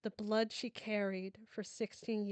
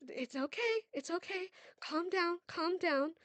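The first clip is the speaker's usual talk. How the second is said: higher, urgent reassurance; anxious calming; 9 semitones higher than usual. Acted. Mother urgently calming her panicking daughter. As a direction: try to calm her while panic rises.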